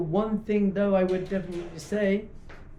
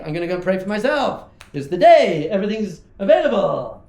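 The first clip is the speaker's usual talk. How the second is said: loud mock excitement, punching the key words; eager self-seeker; 10 dB louder than usual. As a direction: crow it like a greedy opportunist. Voices eager self-centred prayers.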